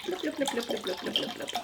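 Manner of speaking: imitated bottle glug; repeated blubs like liquid pouring, tailing down; glugging noise. Real, not acted.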